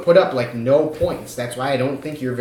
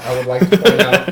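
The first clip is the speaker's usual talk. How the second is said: laughing; 5 semitones higher, 6 dB louder than usual. Real, not acted. Teasing co-host, laughing.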